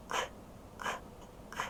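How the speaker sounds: К, к, к as three short clicks with gaps; voiceless consonant. Shown as a demonstration. Teacher isolating a sound for pupils, К, к, к short and voiceless. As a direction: pop К, к, к with no vowel. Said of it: "Глухий".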